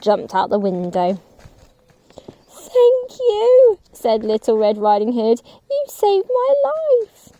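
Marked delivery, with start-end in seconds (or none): 2.5-3.8 put-on very high, louder child voice; high girl's voice, relieved; grateful little girl. Girl thanks woodcutter. 5.7-7.3 put-on very high, slow child voice; high, slow little girl's thanks; grateful little girl.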